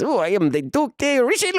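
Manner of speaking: high, put-on voice of a French nobleman; high Duke voice. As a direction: play the Duke in a high, pompous voice. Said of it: "I imagine he talks in a high voice".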